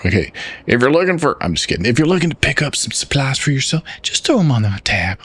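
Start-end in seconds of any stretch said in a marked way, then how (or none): 0.1-1.4 higher Southern voice, breaking off; Southern accent. Acted. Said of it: "I gotta do my southern voice". 1.8-5.2 higher Southern uncle offering supplies; Southern accent. Acted. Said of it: "I gotta do my southern voice".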